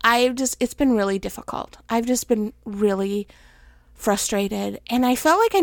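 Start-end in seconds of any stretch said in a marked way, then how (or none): none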